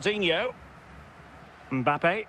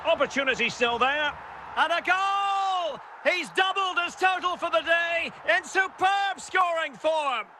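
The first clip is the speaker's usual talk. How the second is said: high-pitched, thrilled match commentary; excited goal call; 10 semitones higher than usual. Pretend. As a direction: build from keen anticipation to a high goal cry.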